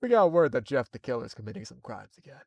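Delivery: lightly voiced neighbour cop; a hint of a gruff cop buddy; Joe Swanson impression. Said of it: "I can do a decent Joe Swanson".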